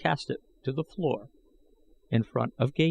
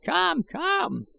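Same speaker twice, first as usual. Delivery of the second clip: put-on high, pleading old voice; old man protesting. A narrator's reading. Old man protesting to threatening Gahan, high and aged. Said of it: "expostulated the old man".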